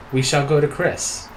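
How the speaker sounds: ordinary talk, nothing marked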